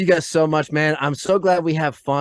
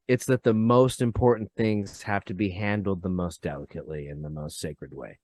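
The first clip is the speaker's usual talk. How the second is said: solemn hush; 8 semitones lower, 7 dB quieter than usual. Real, not acted. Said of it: "solemn warning about sacred things".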